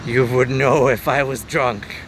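slow, deadpan comeback; dry retort. Real, not acted. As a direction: say it slow and knowing.

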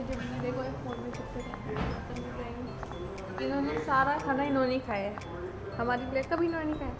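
ordinary talk

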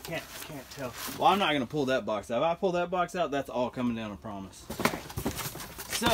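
ordinary talk, nothing marked

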